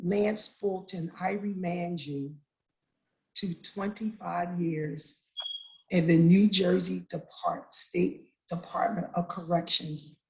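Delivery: low, slow, measured retold ruling; judge passing sentence. Judge sentencing her son to prison, measured and slow. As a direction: hand down the sentence slowly, phrase by phrase. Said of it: "judge say".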